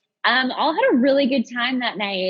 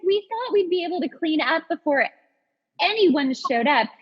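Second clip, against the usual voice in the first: normal talking voice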